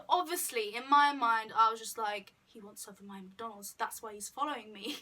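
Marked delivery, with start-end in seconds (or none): 2.4-5.0 quiet inner thought. Retold or imitated. Inner thought, hungry drunk logic.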